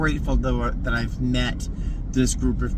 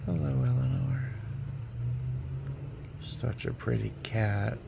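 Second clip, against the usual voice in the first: soft pet talk; 6 dB quieter than usual. Real, not acted. Cooing to the cat again.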